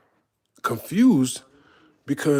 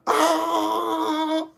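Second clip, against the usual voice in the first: crowd cheer; 14 semitones higher than usual. Retold or imitated. Crowd reacting to the song drop.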